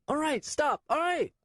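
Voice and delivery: put-on high, frantic boy's voice; frightened child. Nico shoved by Swen, high frightened child. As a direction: beg him to stop shoving.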